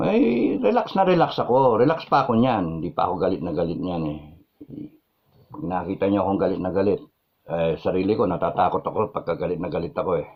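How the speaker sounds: ordinary delivery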